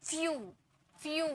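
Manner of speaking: फ्यू as "fee-oo", repeated; spaced model फ्यू with an ee before oo; ee glide. Shown as a demonstration. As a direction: let ee sound inside फ्यू. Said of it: "ई का sound आ रहा है".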